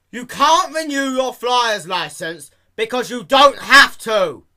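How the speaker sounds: high, loud clerk shout; loud exasperated clerk. Acted. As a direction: outshout the customer. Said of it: "her voice louder than Dash's".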